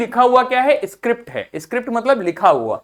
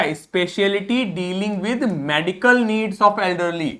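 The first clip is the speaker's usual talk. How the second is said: slow dictation; 3 dB quieter, much slower than usual. Shown as a demonstration. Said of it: "reads definition slowly".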